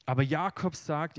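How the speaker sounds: usual speaking voice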